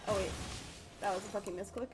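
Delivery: ordinary talk, nothing marked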